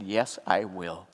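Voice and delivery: hushed, slow, heartfelt; slow tender promise. Retold or imitated. To friend Patrick facing jail, a slow hushed promise. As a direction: promise it softly.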